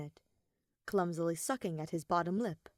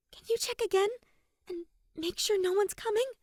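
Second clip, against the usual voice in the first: put-on high, louder young girl's voice; anxious schoolgirl pleading in a raised voice; Kaede's voice; 5 dB louder than usual. Kaede asks Amami to check the door, high and anxious.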